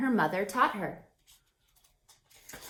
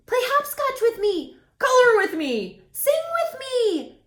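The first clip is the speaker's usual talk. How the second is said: much higher, eager calls; excited children calling; 13 semitones higher, 4 dB louder than usual. A narrator's reading. Animals eagerly ask Jazz to play, much higher. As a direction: beg her to play.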